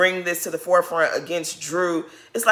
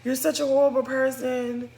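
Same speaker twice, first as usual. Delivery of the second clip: mock dramatic whine; 6 semitones higher, 4 dB quieter than usual. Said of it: "it's like".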